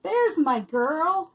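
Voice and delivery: slow, tender, loving; tender greeting. A narrator's reading. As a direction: greet her fondly, slowly. Greeting beloved pet warmly.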